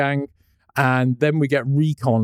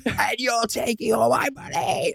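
higher, softer put-on brute voice; a lightly voiced angry warrior; half-hearted villain grumble; Kravex voice; 9 semitones higher, 4 dB quieter than usual.

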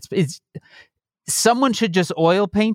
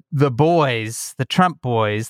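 ordinary talk

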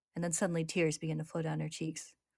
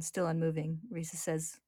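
usual speaking voice

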